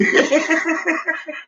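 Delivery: high laugh. Real, not acted. Laughs after mock shout.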